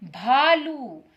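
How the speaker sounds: भालू drawn out slowly with aspirated bh; slowly demonstrated भालू; slow clear demo. Shown as a demonstration. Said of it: "ma'am भा कह रही हैं".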